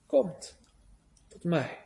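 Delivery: hushed, fading Komt tot mij; soft invitation. Acted. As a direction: barely voice Komt tot mij, tender and low. God's invitation repeated, softer.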